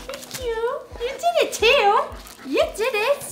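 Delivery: high, squeaky, gushing thanks; giddy thanks. Real, not acted. Answering the plaque's letter, high giddy thanks. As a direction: gush back at the plaque's message. Talking sweetly back to plaque.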